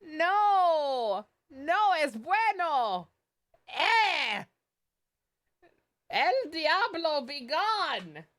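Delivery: high, slow, horrified Spanish outburst; hammed-up disgust in mock Spanish; outraged Spanish. Horrified by shipping in chat.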